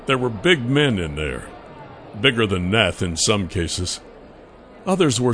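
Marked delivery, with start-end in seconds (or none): none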